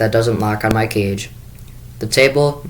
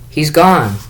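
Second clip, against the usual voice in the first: tearful; 5 semitones higher, 4 dB louder than usual. Acted. Sophie crying to her father.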